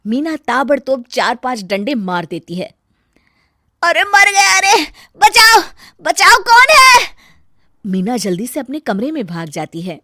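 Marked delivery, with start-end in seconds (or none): 3.8-7.1 high, loud panicked cries for help; screaming in pain. Acted. Rohit, beaten in his sleep, screams for help. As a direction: yell as the blows land. Beaten with a stick while asleep.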